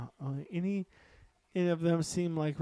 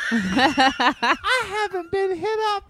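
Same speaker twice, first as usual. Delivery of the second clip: laughing, higher, slow mock Southern drawl; Southern accent; 11 semitones higher, 4 dB louder than usual. Playing the friend for laughs, mock Southern accent. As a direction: ham up a Southern belle while laughing. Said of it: "Why am I Southern?".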